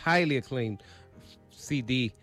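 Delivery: normal talking voice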